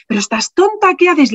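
high, exasperated mock scolding; scolding husband. Husband scolds his wife lying on the chapel floor, high and exasperated. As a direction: throw up your hands at your wife.

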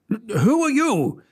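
high, slow startled demand; king scrambles up to question the sprite. Acted. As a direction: insist on a name. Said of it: "demanded the king".

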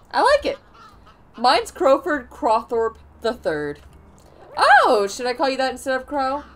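put-on a happy crow greeting an old friend; cheerful crow voice. Crow welcoming the kitty back, cheerful excited voice. Voicing game characters' dialogue.

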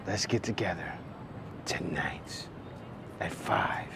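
hushed, low come-on; very soft, low put-on seduction; mock seductive whisper. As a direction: whisper it like a sultry invitation.

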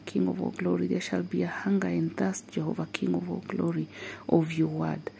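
ordinary talk

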